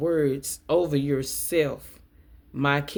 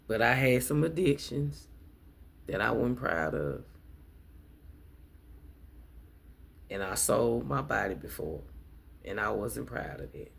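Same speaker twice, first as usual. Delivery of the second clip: much lower and softer, a heavy admission; quiet confession; 6 semitones lower, 7 dB quieter than usual. Real, not acted. Admitting her past to viewers, hushed and ashamed. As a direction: let the shame sink the voice down.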